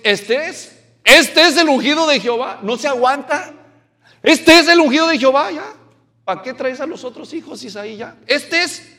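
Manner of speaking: mucho más alto y fuerte, emocionado; Samuel deslumbrado. Retold or imitated. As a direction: grita entusiasmado que lo encontró. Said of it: "Samuel se deslumbra con el tipo y dice". (Samuel deslumbrado ante Eliab, gritando emocionado)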